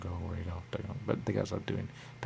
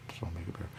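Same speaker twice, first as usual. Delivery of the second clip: soft whispered aside; whispered warning to yourself; 3 semitones lower, 10 dB quieter, much faster than usual. Real, not acted. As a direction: drop to a whisper.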